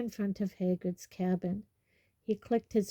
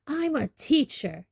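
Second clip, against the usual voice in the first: high, indignant outburst; angry roar; 7 semitones higher, 4 dB louder than usual. A narrator's reading. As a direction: roar it in outrage at a pupil. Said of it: "he roared at Harry".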